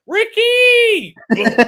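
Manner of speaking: high, loud mock yell of the name; imitated yell. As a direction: shout it like the replay. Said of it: "He was like".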